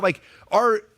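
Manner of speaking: ordinary talk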